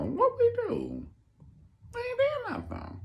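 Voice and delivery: very high, tiny innocent voice; squeaky innocent plea. Retold or imitated. Guests pleading innocence to Aretha, very high squeak. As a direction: play wounded innocence.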